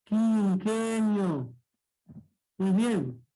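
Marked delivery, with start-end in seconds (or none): 0.1-1.5 quinquenio drawn out slowly, syllable by syllable; split into syllables. Shown as a demonstration. Teacher shows class the syllable split, quinquenio very slow. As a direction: stretch each syllable of quinquenio. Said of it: "cómo sería la separación silábica".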